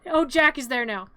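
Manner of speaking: plain reading voice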